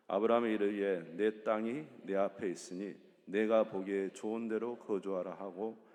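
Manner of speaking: loud, steady group reading of the verse; scripture read aloud. Real, not acted. Leading the congregation in a loud scripture reading. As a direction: read it out together, firm and even.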